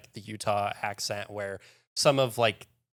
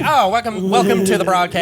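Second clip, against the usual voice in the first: loud, high booming announcer welcome; old radio announcer; 9 semitones higher, 13 dB louder than usual. Retold or imitated. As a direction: open like a 1920s radio show. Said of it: "nineteen twenties radio broadcast".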